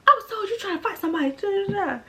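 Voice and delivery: dad on the phone accusing her, mock scolding; fast imitated dad. Dad's voice imitated.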